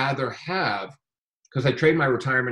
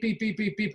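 high, repeated electronic beeps; small high-pitched alarm beeps; imitated beeping; 10 semitones higher, 4 dB quieter than usual. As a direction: mimic a trading screen's alert. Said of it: "hear the screen going beep".

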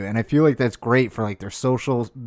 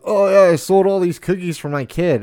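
mock bragging; 5 semitones higher, 4 dB louder than usual. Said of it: "these people, like, brag".

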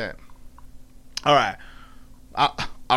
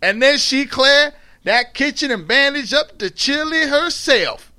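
higher, lively Southern dialect narration; storytelling in dialect; 8 semitones higher, 3 dB louder than usual. A narrator's reading. Recounting her mother's outburst, in animated Southern dialect. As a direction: tell it as a riled-up Southern storyteller.

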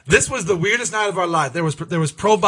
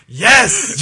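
excited shout. Retold or imitated. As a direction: blurt it out with glee. Eager yes in retold bar scene.

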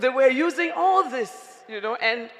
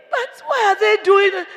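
mock wail; 9 semitones higher, 7 dB louder than usual. Wife mock-wails over the news.

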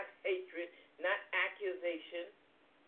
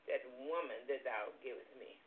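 quiet cold blame; 6 semitones lower, 6 dB quieter than usual. Retold or imitated. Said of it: "he comes up with".

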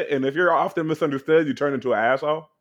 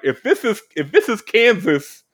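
loud mock confusion, pitched up high; hammed-up disbelief, rising and louder; baffled outburst; 6 semitones higher, 6 dB louder than usual. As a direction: throw it out like a lost, bewildered kid. Voicing a baffled child's confusion.

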